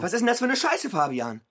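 high, loud furious outburst; furious shout. Acted. Brother confronting Fabian over mother, furious shout. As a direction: explode at your brother.

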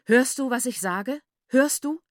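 loud, rising challenge; angry demand. A narrator's reading. Father threatening his daughter, angry demand. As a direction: press the question harder each time.

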